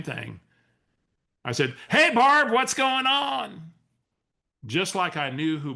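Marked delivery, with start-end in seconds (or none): 1.9-3.7 pitched way up, loud, drawn out; bright fake greeting. To an unknown caller on the car phone, an overeager high greeting. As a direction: greet her like an old friend you can't place.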